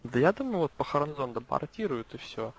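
normal talking voice

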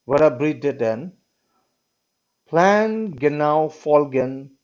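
slow, careful reading of the German words. Shown as a demonstration. Teacher reading the German note to the class, slow and careful. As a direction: sound out each German word slowly. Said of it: "I'll try my best to read the German text".

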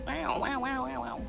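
ordinary delivery

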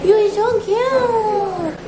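high slow cooing. Real, not acted. Cooing at baby.

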